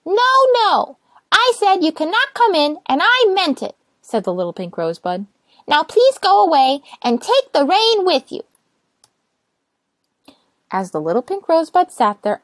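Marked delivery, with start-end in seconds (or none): 0.0-3.8 little rosebud refusing to open the door, high and stubborn. Acted. Rosebud insists firmly. 5.7-8.4 high, loud and cross; annoyed little rosebud. Acted. Rosebud sends them away.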